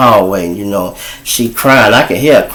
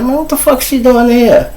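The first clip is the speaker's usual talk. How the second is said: high, startled mock outrage; retold shocked outburst; 14 semitones higher than usual. Seeing a stranger girl in the trap house, high shocked cry. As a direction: squeak it out like you can't believe your eyes.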